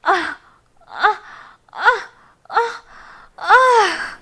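loud rising moans. Acted. Climax moans.

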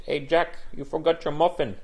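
put-on high-pitched Spanish-accented call. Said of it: "the Spanish guy goes".

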